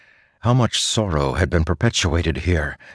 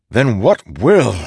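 slow, loud, higher exasperated demand; frustrated; 7 semitones higher, 6 dB louder than usual. A narrator's reading. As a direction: snap it out in frustration.

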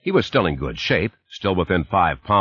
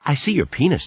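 put-on child voice; 8 semitones higher than usual. A narrator's reading. Little girl giggling at father.

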